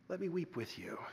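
a near-whisper of comfort to the grieving; Jesus tenderly offering to share the grief, soft; tender hush. Acted.

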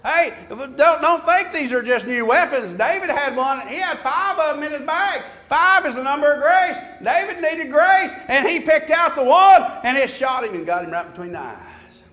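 loud, high, ringing preacher build; preaching at full force. Acted. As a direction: drive it home to the congregation.

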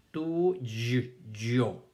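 "Tu, y, yo" sounded out in separate pieces; syllable by syllable. Shown as a demonstration. Teacher sounding out a new word for the class, syllable by syllable. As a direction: break it up: tu... y... yo. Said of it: "¿Cuántas sílabas tiene la palabra".

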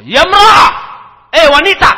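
teriak keras dan tinggi; bentakan keras. Retold or imitated. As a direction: menirukan Ibnu Umar membentak istrinya. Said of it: "Maka beliau ngangkat suara". (Ibnu Umar membentak istrinya dengan keras)

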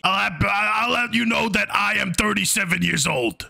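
ordinary talk, nothing marked

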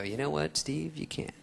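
soft, fading put-down; quiet dismissive. Retold or imitated. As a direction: let him down softly and smug. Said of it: "And I go".

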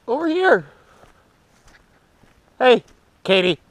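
high, loud call after a dog. Real, not acted. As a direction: shout for the dog to come back. Calling the dog back.

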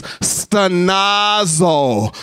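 "stenazo" slowly stretched out; pronunciation demo. Shown as a demonstration. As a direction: model "stenazo" slowly for the room to repeat. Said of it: "say it with me".

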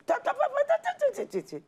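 ta ta ta rattled off very high and quick; rapid mock chatter. Mocking her angry rant in Mama Lori's office, very high rattling ta-ta-ta. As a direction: squeak out fast ta-ta-ta syllables to mimic her own boiling ranting.

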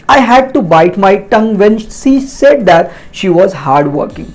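very slow, careful English for learners; teacher reading a model sentence, slowly; slow example read. Shown as a demonstration. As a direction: spell out each word slowly like a teacher.

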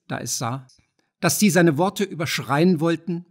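plain reading voice

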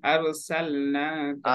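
আরসালনাকা drawn out with an open "sal"; corrected vowel, slowly. Shown as a demonstration. Teacher repeating the correction for a student, slow open vowel. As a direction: stretch আরসালনাকা, keeping "sal" unrounded. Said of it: "সল নয়".

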